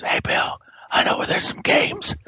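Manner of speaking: hushed, sneaky whisper across to a colleague; conspiratorial whisper. Retold or imitated. Bored colleague leans over in a conference, conspiratorial whisper. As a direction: whisper it like a secret.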